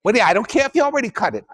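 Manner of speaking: put-on fast, pushy New York accent complaint. Lecturer's deli customer complains in a rapid New York accent.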